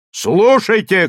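loud, high call for attention; shouted. A narrator's reading. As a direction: shout for the crowd to listen. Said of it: "крикнул глашатай".

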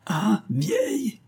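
high, drawn-out indignant repeat; offended squawk; outraged. A narrator's reading. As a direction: throw the insult back, slow and very high. Servant outraged at being called old.

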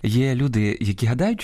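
normal talking voice

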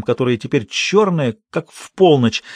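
plain reading voice

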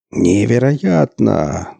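slow booming announcer shout; drawn-out arena yell. A narrator's reading. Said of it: "заорал громкоговоритель".

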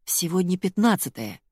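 ordinary delivery, nothing marked

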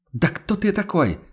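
high, outraged cry from behind the door; a woman yelling through a locked door; indignant outcry. Acted. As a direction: shout it back in disbelief. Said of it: "возмущённо выкрикнул голос".

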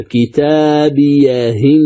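كتابيهن recited slowly with the hamza dropped; drawn-out كتابيهن, kasra on the ha; naql reading. Shown as a demonstration. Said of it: "ثم نحذف الهمزة،".

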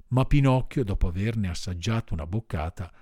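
ordinary delivery

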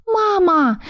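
put-on a little girl overjoyed to see mum; excited child cry. A narrator's reading. Child falls from above, excited. (радостный детский выкрик, намного выше)

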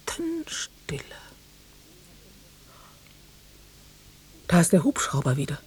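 breathless whisper, the second line fast; whispering while listening for the helicopter. Acted. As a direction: whisper it so no one hears.

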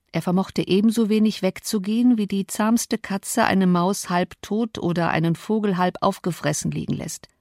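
usual speaking voice

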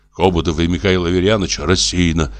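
ordinary delivery

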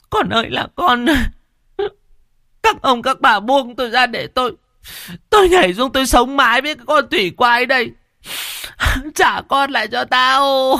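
a mother struggling to leap into the river; hysterical mother. Acted. Hoa trying to jump in river. (mụ Hoa gào thét đòi nhảy xuống sông)

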